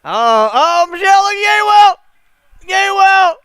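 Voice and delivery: excited shout. Real, not acted. Fan shouting at Michelle to watch the big play. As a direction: scream the name as the play breaks.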